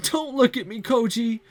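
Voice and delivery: tearful. Acted. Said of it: "begins bawling".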